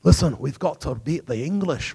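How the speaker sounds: put-on Scottish accent; rally the men in an imitation Scottish brogue; amateur Scottish accent; William Wallace. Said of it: "I'll do my Scottish accent".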